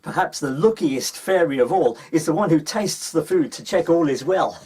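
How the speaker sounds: usual speaking voice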